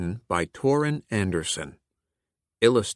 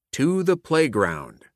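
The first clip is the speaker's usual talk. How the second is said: higher, louder call to the class; pirate's cry; 6 semitones higher, 3 dB louder than usual. A narrator's reading. Pirate substitute rallying students outside, loud cry. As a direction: call them out like a ship's captain. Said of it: "Pirate Chalkbeard cried".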